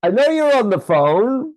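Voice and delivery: loud, high teasing shout. Real, not acted. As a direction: shout across the room to annoy her. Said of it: "I'm going to annoy her and shout".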